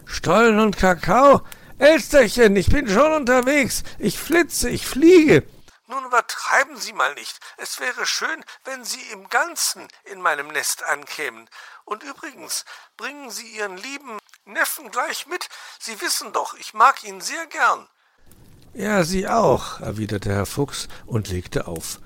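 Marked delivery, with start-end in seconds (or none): 0.0-5.4 very high, much louder delighted cry; excited call. Acted. Uncle fox hears of cake, excited shout. 5.9-17.9 very high bird voice, softening; teasing magpie. Acted. Magpie teases the fox, very high bird voice. 18.7-19.8 high, slow grudging reply; curt old fox. Acted. Uncle fox ends the call, grudging.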